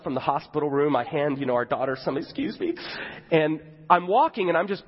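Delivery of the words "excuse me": high, soft, hammed-up tearful squeak; mock tearful. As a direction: excuse yourself while pretending to hold back sobs. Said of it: "I'm losing it".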